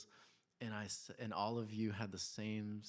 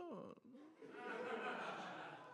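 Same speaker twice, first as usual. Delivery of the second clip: laugh; 6 dB quieter than usual. Real, not acted. Laughing at son's sweetness.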